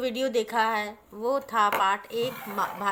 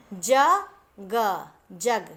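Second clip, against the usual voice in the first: slow sounding out; 2 semitones lower, much slower than usual. Shown as a demonstration. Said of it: "जैसे".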